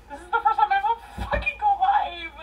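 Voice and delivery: high, loud excited yelling. Real, not acted. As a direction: shout it to the chat. Drunk shouting on stream.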